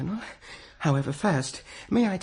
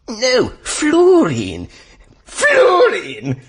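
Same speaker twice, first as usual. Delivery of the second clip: loud, slow, high shout of fluorine; 12 semitones higher, 9 dB louder, much slower than usual. Acted. Mandrake shouting fluorine at the operator. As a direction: bellow it down the phone line.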